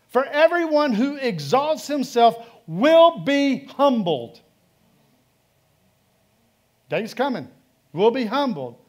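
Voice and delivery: loud, high fervent proclamation; preaching. Acted. Pastor proclaiming Jesus' words on pride, loud and high. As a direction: thunder the warning out.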